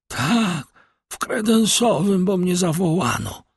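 high-pitched, suffering voice; dying man. A narrator's reading. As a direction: labour to answer in a high voice. Dying servant answers the doctor. (wysoki, udręczony głos umierającego)

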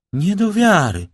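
high, loud narrated cry of disbelief; astonished exclamation. A narrator's reading. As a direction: exclaim it in shock, pitch leaping up. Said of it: "zawołała pani de Villefort".

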